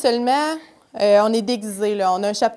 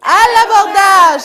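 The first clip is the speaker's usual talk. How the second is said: shouted high and loud; pirate battle cry; 8 semitones higher, 14 dB louder than usual. Shown as a demonstration. Storyteller shouts along with the children, loud battle cry. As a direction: yell it with the kids like pirates. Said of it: "Alors, on crie".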